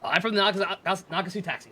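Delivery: normal talking voice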